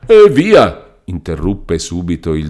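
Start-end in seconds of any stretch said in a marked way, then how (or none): 0.1-0.9 a sudden high, loud outburst; angry interruption. Acted. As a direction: cut him off with a bark. Doctor cuts Renzo off.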